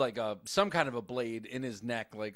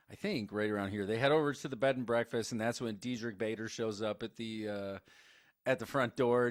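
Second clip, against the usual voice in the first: ordinary talk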